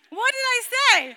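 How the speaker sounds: high, loud laughing question; amused laughing repeat. Real, not acted. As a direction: ask it through a laugh.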